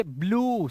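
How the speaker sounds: ब्लू ending in one long oo; single-syllable ब्लू; correct blue. Shown as a demonstration. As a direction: say ब्लू with the e silent. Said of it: "भाई नीले रंग को क्या बोलते हो?".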